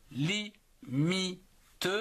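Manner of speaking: "limite" split slowly into li, mi, te; syllable by syllable. Shown as a demonstration. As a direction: separate each syllable of "limite" with a pause, final te sounded. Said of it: "en trois syllabes". (« limite » détaché en trois syllabes : li, mi, te)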